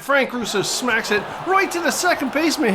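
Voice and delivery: high, loud live call of the hit; excited call. Real, not acted. Gamer calling his hitter's big swing live, excited. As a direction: call the hit as it happens, thrilled.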